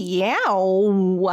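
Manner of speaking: long, stretched cat meow; playful answering meow. Pretend. Host answers co-host's cat call, playful long meow. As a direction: draw the meow right out.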